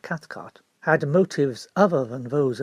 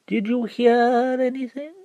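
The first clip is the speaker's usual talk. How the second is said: higher, slow probing question; pompous barrister; 8 semitones higher, slower than usual. Acted. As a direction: ask it as a trap. Barrister cross-examines.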